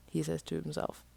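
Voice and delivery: ordinary delivery, nothing marked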